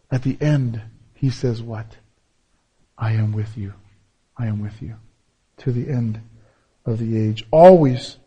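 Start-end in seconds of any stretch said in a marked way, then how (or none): 3.0-7.5 lower, soft, reassuring verse; tender quote. Acted. Quiet tender quoting. 7.5-8.2 always said out loud and firm; emphatic. Shown as a demonstration. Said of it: "loud answer".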